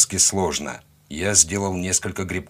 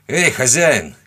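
higher, louder call; shouted hail; 4 semitones higher, 6 dB louder, slower than usual. A narrator's reading. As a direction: hail someone from a distance. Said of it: "крикнул я". (громкий оклик)

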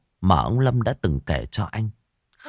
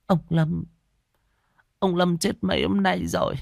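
a higher, lightly voiced hesitant wife; reluctant wife; 9 semitones higher than usual. A narrator's reading. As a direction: break the bad news unwillingly. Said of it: "bất đắc dĩ". (giọng vợ cao, ngập ngừng)

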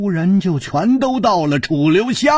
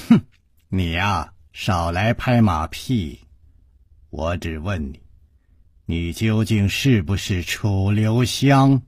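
cold sneer; 9 semitones lower than usual. Acted. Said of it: "冷冷地笑".

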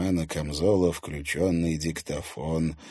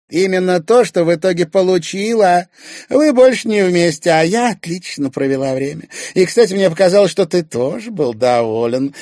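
high, loud taunting woman; pitched-up brazen mockery; gloating Irina; 12 semitones higher, 11 dB louder than usual. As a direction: flaunt the victory to needle him. Irina gloats over her scheme.

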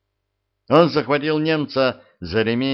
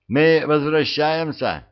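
calling out; 6 semitones higher, 4 dB louder, slower than usual. A narrator's reading. Calling back to the Germans.

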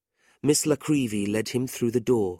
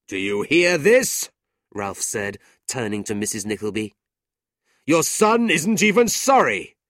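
scornful uncle; 3 semitones higher, 7 dB louder than usual. A narrator's reading. Ralph scornfully turns to Mrs Nickleby. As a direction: sneer at his pride.

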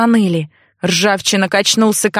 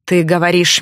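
ordinary delivery

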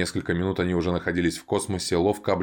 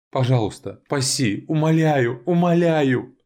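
building from plea to high shrieking; frantic begging; 8 semitones higher, 4 dB louder, slower than usual. Acted. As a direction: beg harder and harder until screaming. Sinking, begging, rising to scream.

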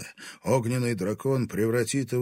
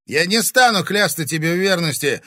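loud, high defiant exclamation; exclaiming; 10 semitones higher, 11 dB louder than usual. Acted. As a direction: cry it out in defiance. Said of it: "воскликнул он".

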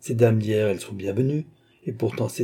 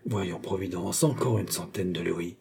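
murmured; 2 semitones lower, 7 dB quieter than usual. A narrator's reading. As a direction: murmur it privately, much softer. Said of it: "elle murmura".

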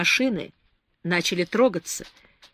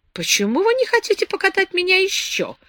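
put-on high, whiny plea of a spoiled girl; petulant girl pleading to keep driving; capricious; 11 semitones higher than usual. A narrator's reading. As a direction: sulk like a tipsy child begging for more. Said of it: "капризно спросила Беатрис".

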